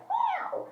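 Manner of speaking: tiny high-pitched mewing cry; mock kitten mew; kitten meow. Imitating the crying kitten.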